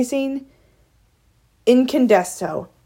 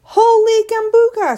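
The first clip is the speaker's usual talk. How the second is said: astonished exclamation; 13 semitones higher, 4 dB louder than usual. Acted. Girl reading unbelievable typed words, high astonished outburst. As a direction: exclaim it high, stunned by the sight. Flora astonished, then a scream echoes.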